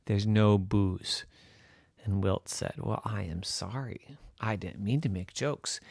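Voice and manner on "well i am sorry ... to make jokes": soft, low and sheepish; sheepish apology. Acted. Wilt apologising to the ghost, meek and soft. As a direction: apologise meekly, much quieter.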